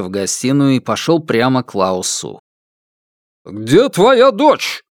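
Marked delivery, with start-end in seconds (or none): 3.4-4.8 loud, high, drawn-out demand; angry demand. A narrator's reading. Father demands the man's daughter, angry shout. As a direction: shout it slowly, pitched high. Furious father confronts the man.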